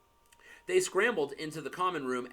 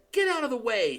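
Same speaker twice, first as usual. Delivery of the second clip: higher, louder snap; snapped; 8 semitones higher, 6 dB louder, faster than usual. Acted. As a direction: bark it at the ghost. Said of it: "snapped Ron".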